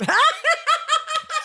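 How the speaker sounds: sudden squealing burst of laughter; high shrieking laugh. Real, not acted. Reacting to being teased.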